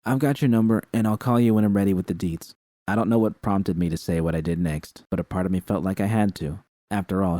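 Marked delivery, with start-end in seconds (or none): none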